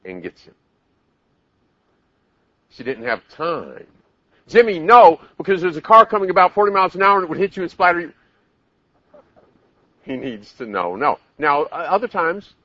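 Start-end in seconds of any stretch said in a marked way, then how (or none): 4.5-5.2 loud, high urgent shout; acted alarmed parent's yell; yelled warning. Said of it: "they yell". 5.4-8.1 high, loud, very fast rattle; mock-urgent babble at raised pitch; frantic explanation. Absurd explanation yelled in emergency.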